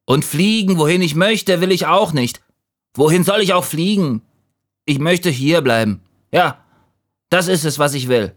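high, insistent little leaf; stubborn refusal. A narrator's reading. Leaf insists on staying in the tree, stubborn high voice.

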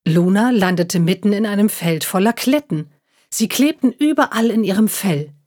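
ordinary delivery

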